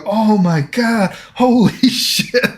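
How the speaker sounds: imitating the crowd, high, slow and laughing; a hammed-up crowd gasping through laughter; retold laughing gasp. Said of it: "And they were like".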